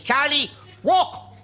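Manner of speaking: high, slow solemn command; mock Pope, drawn out and pitched high. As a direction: play the Pope ordering a miracle. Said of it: "he didn't have an Irish accent".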